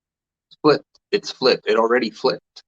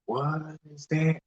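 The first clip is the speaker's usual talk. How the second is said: puzzled wonder; 6 semitones higher, 8 dB quieter than usual. Retold or imitated. Recalling his own puzzled reaction.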